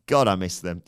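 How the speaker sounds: ordinary delivery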